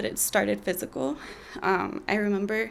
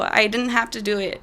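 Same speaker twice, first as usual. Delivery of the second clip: usual speaking voice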